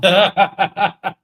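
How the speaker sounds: a big real laugh out loud; loud burst of laughter. Real, not acted. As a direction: laugh out hard at the remark. Laughs at guest's remark.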